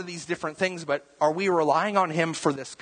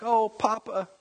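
put-on high little-kid plea; a small child crying for grandpa; toddler voice; 8 semitones higher than usual. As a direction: reach out like a toddler begging Papa. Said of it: "the little kid's like".

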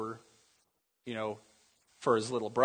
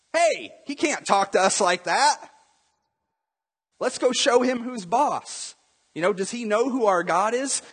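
put-on high-pitched, fired-up boy; acted youthful outrage, pitched up; indignant young boy; 7 semitones higher than usual. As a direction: play a boy rallying the grown-ups. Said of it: "a young boy comes up".

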